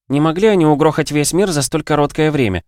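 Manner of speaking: high-pitched protesting character voice; objecting. A narrator's reading. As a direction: argue back, pitch lifted. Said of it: "возразила Юля".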